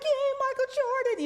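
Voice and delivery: high, loud cheering crowd; mock cheer. Fans cheering a crying champion, high and loud. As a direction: cheer like a fan in the stands. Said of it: "They're like".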